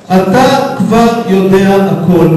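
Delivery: slow, measured word by word; deliberate retort. Real, not acted. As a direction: spell it out slowly for the heckler.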